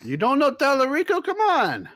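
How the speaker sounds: high, loud disbelieving outburst; incredulous protest. Real, not acted. Teasing a co-host for not knowing the man, incredulous. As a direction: pitch it way up in comic disbelief. Mock outrage at co-host's ignorance.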